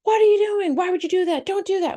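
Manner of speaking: high, loud alarmed scolding; upset parent yelling. Retold or imitated. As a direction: snap at the kid in a panic. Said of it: "we go".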